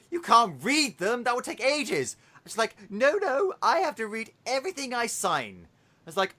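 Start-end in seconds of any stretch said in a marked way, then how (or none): 0.1-2.2 mock flustered protest. Acted Duke protesting to Aurora. 2.9-5.7 high-pitched, lightly acted refusal; mock princess insistence. Acted Aurora insisting to the Duke.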